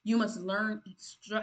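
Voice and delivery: ordinary delivery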